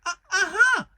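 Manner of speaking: very high, loud stammered squeal; anxious Piglet. A narrator's reading. Piglet looks anxiously for the others, high stammered cry. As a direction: stumble on the first syllable, then squeal it out. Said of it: "looking around anxiously for the others".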